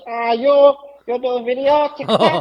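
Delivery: put-on high, Kermit the Frog-like Vietnamese accent; his voice. Showing the co-host how the shop owner talks, high Kermit-like imitation. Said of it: "That's how he talks".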